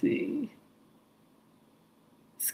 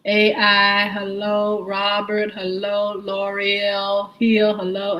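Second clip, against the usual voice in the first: ordinary delivery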